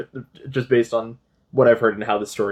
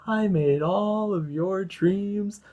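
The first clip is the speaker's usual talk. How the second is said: higher, very slow, otherworldly monster voice; eerie void creature; 5 semitones higher, much slower than usual. Retold or imitated. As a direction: stretch each word out like something that isn't human. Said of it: "voidless being just being like".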